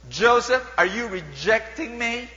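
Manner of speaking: loud, high, slowed indignant demand; hammed-up outrage at being refused; offended mistress. Potiphar's wife confronting Joseph, loud and indignant. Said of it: "acted Potiphar's wife, offended".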